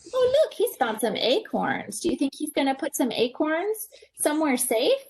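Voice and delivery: plain reading voice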